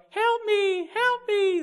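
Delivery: high, shrill-sounding melting cry, far above his own voice; Wicked Witch of the West. Retold or imitated. Preacher recalling the melting witch: high, crying witch voice. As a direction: wail it like the witch melting away in the film. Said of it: "the Wicked Witch of the West".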